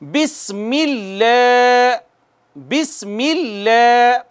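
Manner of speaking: बिस्मिल्लाह slow, with a hissing स; slow बिस्मिल्लाह, sharp स; slow recitation. Shown as a demonstration. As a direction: recite बिस्मिल्लाह slowly, whistling the स. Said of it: "आप मुझे follow कीजिएगा".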